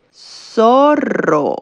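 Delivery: "Zorro" with a strongly rolled R. Shown as a demonstration. Teacher shows that the R sounds strong, rolled R in "Zorro". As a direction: roll the double r hard in "Zorro". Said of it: "La R suena fuerte".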